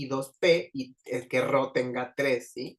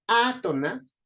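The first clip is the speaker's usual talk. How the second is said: átonas with a raised first syllable Á; stress on Á; 7 semitones higher, slower than usual. Shown as a demonstration. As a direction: lift the Á at the start of átonas. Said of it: "a sería la sílaba tónica". (átonas marcando la Á inicial)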